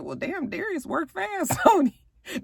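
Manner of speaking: high, amused aside; impressed aside. Real, not acted. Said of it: "I said".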